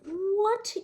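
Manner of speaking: usual speaking voice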